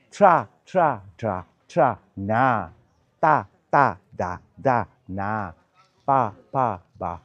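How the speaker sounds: 慢速示范发音; slow syllable demo. Shown as a demonstration. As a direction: shape each syllable slowly. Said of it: "你看我都没有关到".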